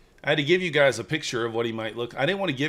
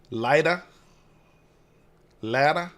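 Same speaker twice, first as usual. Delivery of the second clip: Maine accent, raised and questioning; trying a Maine accent; 4 semitones higher, 5 dB louder than usual. Shown as a demonstration. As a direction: try dropping the R like a Mainer. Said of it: "what else can I do it with?".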